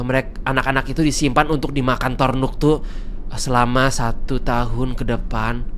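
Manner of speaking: low, hushed lore reading; spooky story narration. Acted. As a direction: read the legend like a campfire tale. Reads creepy lore. (narasi dongeng seram, rendah dan pelan)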